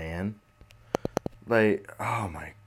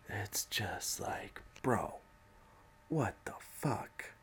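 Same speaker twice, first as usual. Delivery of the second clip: slow, softer whispered what the fuck; disbelieving whisper; 2 semitones higher, 10 dB quieter, slower than usual. Real, not acted. Stunned by the president's tweets, a slow whisper.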